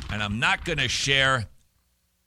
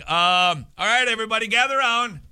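high, raised crowd-gathering call; mock calling out; 9 semitones higher, 4 dB louder, faster than usual. Imagining Jesus summoning a crowd, hammed-up calling out. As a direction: call everyone over, pitched well up.

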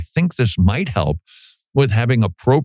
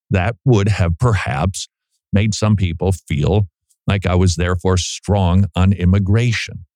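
normal talking voice

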